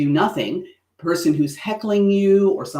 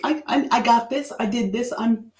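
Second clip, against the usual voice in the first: higher voice, boasting; proud; 8 semitones higher than usual. Shown as a demonstration. Showing pride in an achievement, high and boastful. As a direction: own it like you earned it. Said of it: "exude pride".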